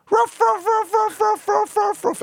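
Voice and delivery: very high, rapid yapping barks; high-pitched relentless dog yaps; dog barking. Retold or imitated. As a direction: bark like a small excited dog. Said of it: "So I talk like a dog to her".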